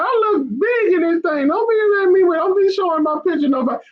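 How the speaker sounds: high, put-on whiny wife voice; imitating wife. Husband mimicking his wife fretting over her bulky photo, high and whiny. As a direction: mimic her complaining she looks bulky. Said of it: "She's like".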